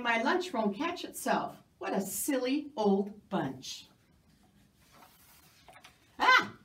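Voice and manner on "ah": high, loud gasp of shock; startled cry. Acted. Narrator crying out in shock at the surprise. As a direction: jump with fright.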